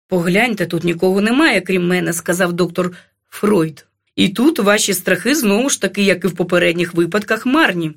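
low-pitched, composed psychiatrist; reassuring doctor. A narrator's reading. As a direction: reason with the patient in a deep voice. (лікар заспокоює низьким голосом)

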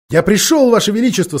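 put-on high, eager boy's cry; eager greeting. A narrator's reading. Edmund rushes to the queen.

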